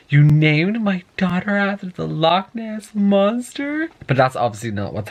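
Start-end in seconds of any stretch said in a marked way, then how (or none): 0.1-3.9 high, slow, incredulous mock outrage; imitating outraged Bella. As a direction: play up the mother's disbelief, slowly. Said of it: "she's just like".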